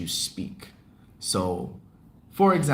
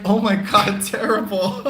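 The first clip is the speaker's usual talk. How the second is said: imitating a listener's reaction; 9 semitones higher, 6 dB louder than usual.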